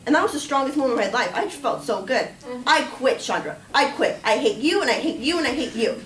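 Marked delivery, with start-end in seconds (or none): 2.6-6.0 loud, triumphant re-enacted tirade; defiant outburst. Employee quitting on her boss, loud defiant outburst. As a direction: hurl each phrase at the boss. Quits in her boss's face.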